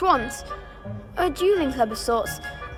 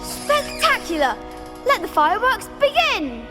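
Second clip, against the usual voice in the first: excited announcing; 6 semitones higher than usual. Acted. Duel organiser sends a new duelist into the ring, excited announcing. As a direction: open the contest with big rising excitement.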